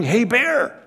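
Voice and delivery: high-pitched imitated yell, not full volume; retold yell. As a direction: mimic campers calling out a bear warning. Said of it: "And they're yelling".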